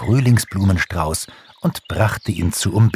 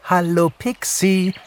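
high-pitched cheering bird voice; jubilant; 13 semitones higher than usual. A narrator's reading. Returning birds greet their friend Pixie, cheering. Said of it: "jubelten sie".

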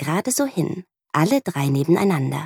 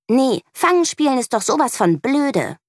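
put-on higher, snappy, hissing child; 8 semitones higher than usual. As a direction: snap it back with disdain. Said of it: "faucht sie". (fauchend, höher, schnippisch)

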